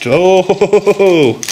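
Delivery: drawn-out startled oh. Real, not acted. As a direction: caught off guard mid-sentence. Stone suddenly flies past.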